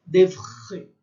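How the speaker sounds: "D'oeuvre" with a rasping French R in the throat; throat-scraped R. Shown as a demonstration. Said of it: "raspa a garganta".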